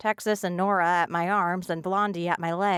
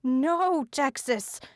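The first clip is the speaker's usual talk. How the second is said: high, furious protest; rare fury; 7 semitones higher, much slower than usual. A narrator's reading. Gentle friend furiously protests to Texas. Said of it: "A rare note of fury".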